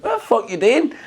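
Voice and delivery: high, mock-annoyed demand; retold indignant challenge. Retelling his annoyance at another car, indignant. Acts out his own road rage.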